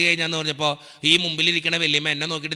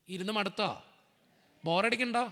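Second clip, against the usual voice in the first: usual speaking voice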